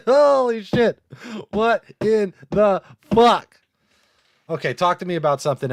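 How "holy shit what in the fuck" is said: stunned laughter. Real, not acted. Laughing in disbelief at scroll.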